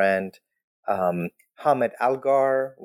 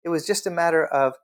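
ordinary delivery

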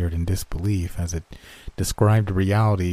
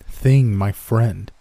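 usual speaking voice

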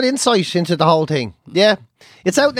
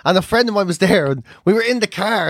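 ordinary delivery

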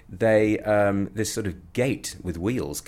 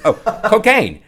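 high, loud, with a laugh; loud laughing guess; officer naming drug; 13 dB louder than usual. Retold or imitated. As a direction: announce the next drug on the list. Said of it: "So they're part of the next one".

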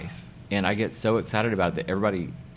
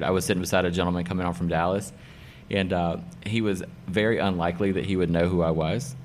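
usual speaking voice

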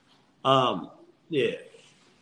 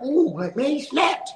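much higher, impressed ooh; amazed friends; 12 semitones higher, slower than usual. Retold or imitated. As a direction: act blown away by the rap. Friends reacting to his rap.

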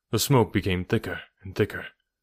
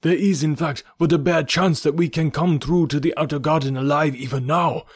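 higher and louder, hurried alarm; urgent cry; 7 semitones higher, 4 dB louder than usual. A narrator's reading. Zodar urges them to flee the fire, loud high alarm. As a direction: shout them out of the smoke.

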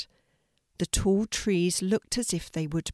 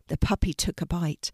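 ordinary delivery, nothing marked

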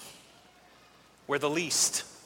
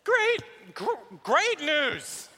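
bigger excited announcement; 6 semitones higher, much slower than usual. Acted. David restarts his announcement.

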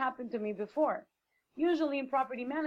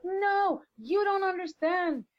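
higher, louder, slower shout of no; desperate woman yelling back, much louder and higher; retold yell; 8 semitones higher, 5 dB louder, slower than usual. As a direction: belt it out as she yelled it. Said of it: "And then she yelled at me".

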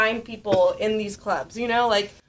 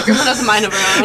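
laughing; 13 dB louder, faster than usual. Real, not acted. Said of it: "Like I said".

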